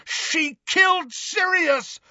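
high, slow, strained furious cry; bellow. A narrator's reading. Harry breaking free to chase Bellatrix, bellowing. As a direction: bellow the accusation in raw fury. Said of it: "bellowed Harry".

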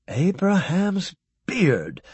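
stunned by what the probe shows; astonished oath. A narrator's reading. Horowitz seeing the warrior creature, slowly amazed.

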